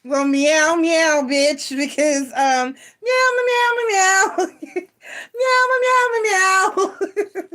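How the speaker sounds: high laughing mock meows; cat meowing. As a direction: meow like a cat through giggles.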